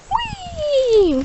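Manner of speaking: high playful whee; excited. Real, not acted. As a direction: cheer the bird into flight. Bird launched.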